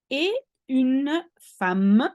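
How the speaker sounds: et une femme drawn out slowly, femme said fam; teacher showing femme sounds like fam; slow model phrase. Shown as a demonstration. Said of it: "You say femme".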